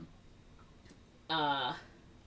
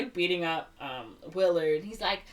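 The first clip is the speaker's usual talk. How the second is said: ordinary talk, nothing marked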